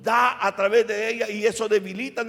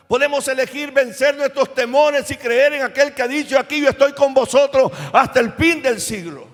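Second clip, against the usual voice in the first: rising, louder preaching; 2 semitones higher, 5 dB louder than usual. Acted. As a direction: build the sermon to full force. Predica con fuerza creciente. (predicación con fuerza, más fuerte)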